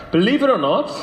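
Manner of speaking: ordinary talk, nothing marked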